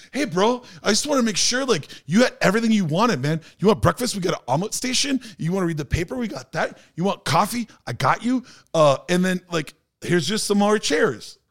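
high, eager hyped-up bro voice; high mock coked-up bro. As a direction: imitate the wired guest who offers everything.

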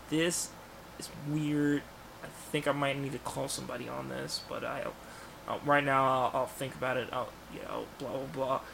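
very soft, tentative thinking aloud; musing to himself. Acted. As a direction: puzzle it out under your breath. Acts out Peter musing to himself.

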